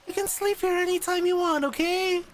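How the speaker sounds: high, gushing reassurance; eager comforting. Acted. Sensei eagerly reassures Maya, high and gushing.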